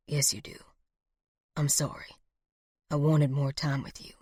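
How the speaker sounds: low, soft, failing voice; weakly dying. A narrator's reading. Dying man apologizing to lover, low and weak. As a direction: murmur a last regret, low and faint.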